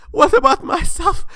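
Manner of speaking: very high, loud, pleading voice; desperate believer's question; gullible questioner. Acted. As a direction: beg the god about yourself.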